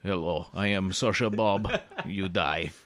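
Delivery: deadpan threat in a fake Japanese-Russian accent; Japanese-Russian hitman. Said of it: "it's like a Japanese Russian".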